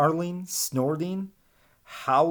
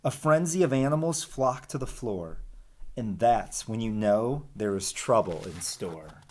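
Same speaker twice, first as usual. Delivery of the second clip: lively storybook read-aloud; animated picture-book reading; reading to children; 4 semitones higher than usual. Acted. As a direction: read the rhymes to the class with bounce. Teacher reads rhyming picture book.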